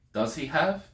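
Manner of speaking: "have" lifted high at the end; questioning intonation. Shown as a demonstration. Teacher translating a Greek question, questioning intonation. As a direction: rise on "have" as if asking. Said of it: "as long as there's a rise in tone".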